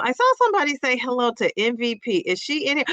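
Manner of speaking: ordinary talk, nothing marked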